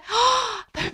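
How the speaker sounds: sharp high gasp; shocked gasp. Acted. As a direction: gasp in mock horror. Giant gasps again.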